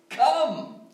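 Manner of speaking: "Come" lifted high and called out, falling; exclaimed come. Shown as a demonstration. Preacher voicing Jesus' call to the weary, "Come" exclaimed high. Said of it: "is with an exclamation mark".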